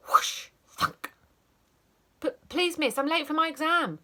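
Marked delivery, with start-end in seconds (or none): 2.2-4.0 much higher, louder plea; pleading child. Acted. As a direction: beg like a panicked child.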